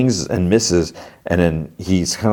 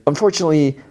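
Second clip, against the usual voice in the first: higher, oily salesman patter; snake oil salesman; 7 semitones higher than usual. Retold or imitated. Salesman delivering McLean's message, higher salesman voice. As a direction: pitch it like a sly salesman. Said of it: "He's like".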